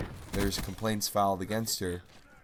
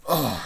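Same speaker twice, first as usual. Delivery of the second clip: annoyed groan; 6 semitones higher, 7 dB louder than usual. Real, not acted. Annoyed remembering the teacher.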